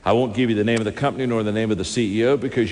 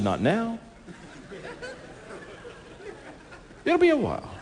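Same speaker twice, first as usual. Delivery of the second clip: high, playful put-off; teasing refusal. Retold or imitated. Preacher telling the CEO he is not ready, high and playful. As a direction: tease him and wave it away.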